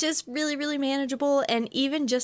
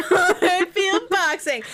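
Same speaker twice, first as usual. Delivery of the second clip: laughing; 7 semitones higher, 5 dB louder, slower than usual. Real, not acted. Echoing joke while laughing.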